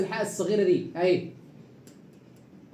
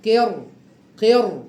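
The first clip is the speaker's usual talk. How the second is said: قر punched louder, ra thick; loud heavy ra; 6 dB louder, slower than usual. Shown as a demonstration. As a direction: hit قر hard. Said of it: "يبقى تفخم الراء هكذا".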